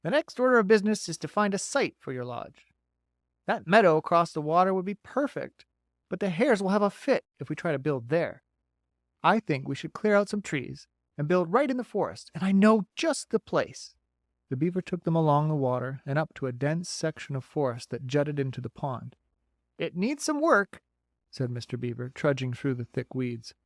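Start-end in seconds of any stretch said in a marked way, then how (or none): 0.0-14.0 bright, folksy beaver; a chatty beaver, a bit higher. Acted. 19.8-20.8 bright, folksy beaver; a chatty beaver, a bit higher. Acted.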